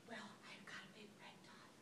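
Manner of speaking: whispered embarrassed confession; soft spa whisper. Real, not acted. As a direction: breathe it out, ashamed. Timid confession at spa desk.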